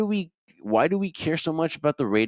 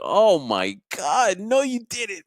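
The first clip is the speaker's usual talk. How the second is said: mocking voice; 9 semitones higher, slower than usual. Mocking a wrestler's promo.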